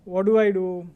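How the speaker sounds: ordinary talk, nothing marked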